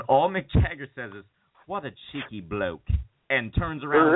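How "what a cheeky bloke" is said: soft, mock British dismissal. Rock star brushing off an eager fan, mock English accent. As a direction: brush someone off in a quiet, put-on English accent. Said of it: "too much [name] accent there".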